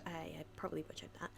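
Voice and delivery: breathy whispered aside; whispering. Real, not acted. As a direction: whisper it to yourself. Self-conscious aside on pronunciation.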